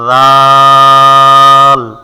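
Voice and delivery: tongue-tip th, long level vowel; drawn-out dhal. Shown as a demonstration. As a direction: stretch it loudly on one low, steady note. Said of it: "রাল একই মাখরাজ".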